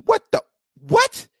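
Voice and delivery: can't believe what he just heard; stunned disbelief. Real, not acted. Host reacting to the resisting claim with high slow disbelief.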